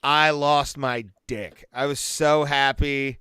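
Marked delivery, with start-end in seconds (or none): none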